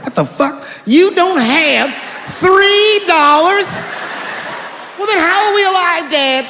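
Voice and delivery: put-on twelve-year-old aghast dad has no money; shocked kid. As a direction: shriek it like a stunned kid.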